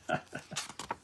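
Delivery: quiet unvoiced chuckle; a hushed laugh at the picture; soft breathy laugh. Real, not acted. Reader laughs softly.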